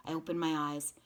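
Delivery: ordinary delivery, nothing marked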